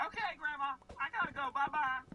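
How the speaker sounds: high sweet Southern granny voice; old Southern lady accent. Retold or imitated. Faking a call to Grandma on the phone, high Southern granny voice. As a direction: chirp it up high like a folksy grandma. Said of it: "with that accent".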